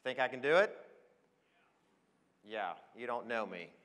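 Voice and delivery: ordinary talk, nothing marked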